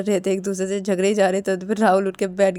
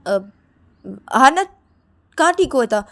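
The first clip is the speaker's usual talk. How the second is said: raised, louder rebuttal; argumentative denial; 5 semitones higher, 6 dB louder than usual. Acted. Shruti insists her brother was still unwell, arguing. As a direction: dispute it, pitched up.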